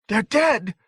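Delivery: put-on very high, triumphant child's cry; hysterical triumph. A narrator's reading. Boy sees burned hedge creatures, hysterically triumphant. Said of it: "Danny screamed in hysterical triumph".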